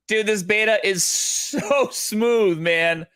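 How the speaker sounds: slow, drawn-out gushing over smooth; crypto bro. Real, not acted. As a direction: savor every word, totally hyped. Gushing over the game beta.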